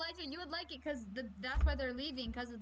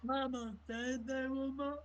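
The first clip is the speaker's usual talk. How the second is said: Forrest Gump impression; 7 dB louder than usual. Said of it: "Forrest Gump or something?".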